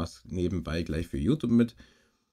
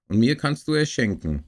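put-on higher, lightly insistent child's plea; called out; 5 semitones higher, 6 dB louder than usual. A narrator's reading. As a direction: press the point with a mild call. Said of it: "ruft Gerold". (leicht gerufen, drängend)

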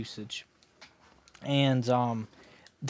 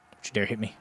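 ordinary talk, nothing marked

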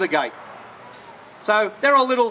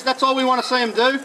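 usual speaking voice

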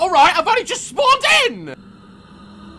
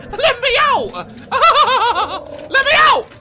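laughing panic; 8 semitones higher than usual. Real, not acted.

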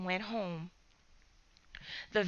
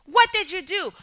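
louder, high angry yell; father shouting at the boys in shock; screaming; 7 semitones higher, 5 dB louder than usual. Acted. Angry father yelling What did you do at the boys. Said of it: "rushed out of his car screaming".

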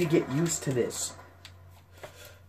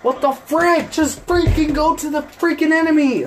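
high, loud raging yell; real furious yell; 10 dB louder than usual. Real, not acted. Gamer yelling at teammates to attack, real furious yell. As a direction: scream orders at your useless teammates. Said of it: "I'm getting so pissed off".

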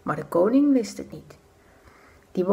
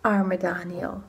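pitying sigh; slower than usual. A narrator's reading. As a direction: breathe it out with sorrow. Pitying aside, slow soft. (zacht medelijdend)

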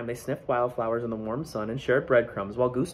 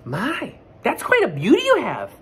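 higher and louder, admiring; admiring bluebird; 11 semitones higher, 6 dB louder than usual. Acted. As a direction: coo over it like a little bluebird. Bluebird character voice.

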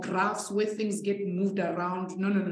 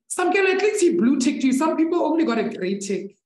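teasing; 7 semitones higher, 5 dB louder than usual. Real, not acted. Teasing student about WhatsApp ticks.

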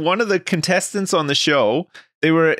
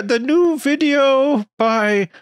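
slow, high mock voice of a clueless viewer; lightly voiced viewer, high and slow; mock dumb viewer; 9 semitones higher, slower than usual. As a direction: drawl it out like a clueless fan.

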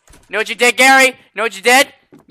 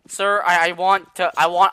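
usual speaking voice

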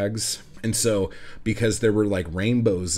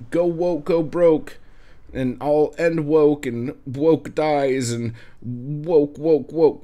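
mocking sneer; 6 semitones higher, 5 dB louder, slower than usual. Mocking politicians' slogans.

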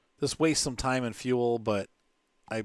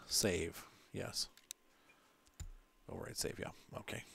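whispering; 3 semitones lower, 11 dB quieter than usual. Real, not acted. Quiet aside while saving.

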